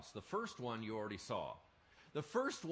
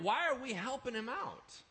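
ordinary talk

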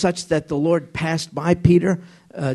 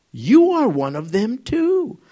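slow, high, louder accusing voice; acted-out accuser, slow and insistent; pointed accusation; 7 semitones higher, 5 dB louder, slower than usual. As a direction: draw out the accusation, pitched up. Another accuser's line acted out.

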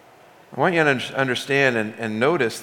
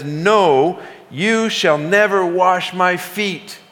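slow, higher emphatic refusal, every word pressed; 5 semitones higher, much slower than usual. Retold or imitated. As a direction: insist slowly that he will never let it happen. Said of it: "He emphatically says".

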